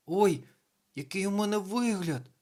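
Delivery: high, dismayed exclamation; horrified. A narrator's reading. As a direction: cry out aghast at yourself. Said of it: "жахнулося воно".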